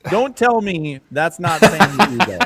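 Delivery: high, loud protest breaking into laughter; words giving way to real laughter; real laughing. Real, not acted. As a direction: insist on it while cracking up. Cracking up at a lookalike photo.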